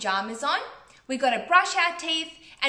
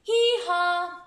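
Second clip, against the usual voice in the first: loud, high drawn-out hee-haw; donkey bray; 5 semitones higher, 5 dB louder than usual. Acted. Donkey braying in a story.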